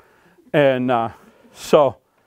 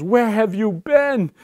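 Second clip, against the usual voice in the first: high, drawn-out mother's cry; frantic mother; 9 semitones higher, slower than usual. Acted. Mother to her missing little boy just found, frantic. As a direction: stretch it out high, as a worried mother. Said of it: "she goes".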